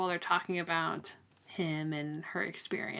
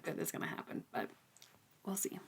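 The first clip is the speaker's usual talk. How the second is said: whisper; 11 dB quieter, faster than usual. Real, not acted. Ominous prediction about Fox Boy.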